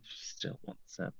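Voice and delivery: slow, barely audible whisper; dramatic slow whisper; hushed whisper. Acted. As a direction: whisper it slowly, savouring the drama. Echoes line in hushed awe.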